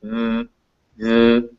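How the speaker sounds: "the" as an isolated buzzing th; voiced th alone. Shown as a demonstration. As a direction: hum the th of "the" with the throat vibrating. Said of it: "your throat will".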